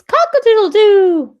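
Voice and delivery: high, loud crowing cock-a-doodle-doo; rooster crow. Acted. Teacher voicing the rooster in the story, high crowing.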